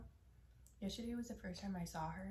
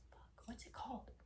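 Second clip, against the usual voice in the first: whispering; 5 semitones higher, 6 dB quieter than usual. Real, not acted. Muttering, trying to recall.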